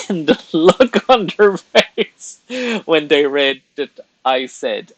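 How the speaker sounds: high, loud, through laughter; amused, laughter bubbling through the words; laughing. Real, not acted. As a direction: tell it while cracking up. Laughs recalling streamer's reaction.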